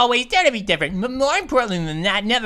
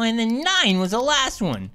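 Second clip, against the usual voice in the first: usual speaking voice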